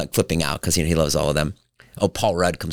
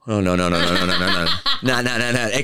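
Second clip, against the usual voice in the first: laughing no. Retold or imitated. Laughing refusal.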